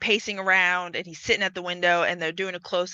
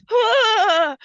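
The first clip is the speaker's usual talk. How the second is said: sharp high gasping scream; sudden scream; 12 semitones higher, 8 dB louder than usual. Retold or imitated. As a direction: jolt up with a startled scream. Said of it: "she just all of a sudden goes".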